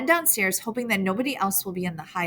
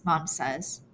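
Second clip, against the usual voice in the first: ordinary delivery, nothing marked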